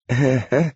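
short questioning laugh; a nervous, asking little laugh; inquiring laugh. A narrator's reading. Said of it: "Brother Francis laughed inquiringly".

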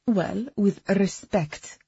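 put-on slow accented Well, with respect; mocking echo. A narrator's reading. Agnes mimics Tab's phrase.

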